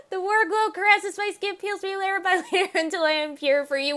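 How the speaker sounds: high, quick reading broken by laughter; laughing read. Shown as a demonstration. Streamer reading the character's eerie line while laughing. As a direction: read her creepy line while cracking up.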